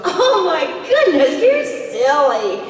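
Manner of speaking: high, loud, drawn-out gush with a laugh; hammed-up misreading, far too big and jolly; over-the-top line reading. Shown as a demonstration. Actress shows fans a bad line reading, gushing hugely. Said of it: "we do really bad line readings".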